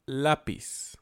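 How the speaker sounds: "lápiz" slow, stress on LÁ; first-syllable stress. Shown as a demonstration. Teacher giving an example, first-syllable stress on "lápiz". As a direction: lean on the LÁ of "lápiz". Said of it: "la parte fuerte es la".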